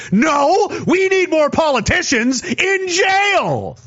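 forceful slow yell; angry shout. Real, not acted. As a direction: shout your answer back at them. Rant, shouting his answer.

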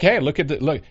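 ordinary delivery

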